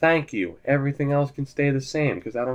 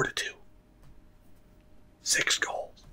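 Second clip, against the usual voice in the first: hushed whisper of triumph; 12 dB quieter than usual. Real, not acted. Gamer whispers the score to his stream, hushed. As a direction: breathe the words out almost silently. Gamer savoring hockey result.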